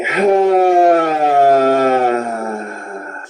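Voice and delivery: a long audible sigh of release; big sigh. Shown as a demonstration. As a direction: let it all out in one long breath. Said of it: "let out a big sigh".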